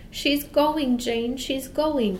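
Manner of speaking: high, slowing, tearful cry; a boy crying out as she leaves; weeping cry. A narrator's reading. Young Michael calls to his sister as Mary Poppins flies away, weeping. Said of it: "cried Michael, weeping".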